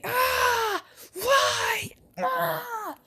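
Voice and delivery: high crying shouts of pain; high anguished wails; pained cries. Acted. Toe being pulled.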